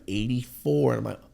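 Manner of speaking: normal talking voice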